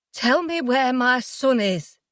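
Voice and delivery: slow, every word spaced out; very slowly and clearly. Acted. Mother telling Jay slowly and clearly to give up her son. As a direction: spell it out slowly for him. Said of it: "very slowly and clearly".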